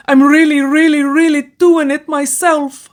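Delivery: high, loud, breaking with excitement; ecstatic cry. A narrator's reading. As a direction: let her triumph spill over. Eleanor driving at tree.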